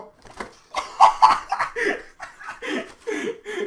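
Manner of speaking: loud helpless laughter; laughing fit. Real, not acted. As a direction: burst out laughing. Laughing fit at old crackers.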